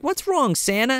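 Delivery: lightly voiced concerned kid; a kid asks Santa what's wrong. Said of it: "The kid's like".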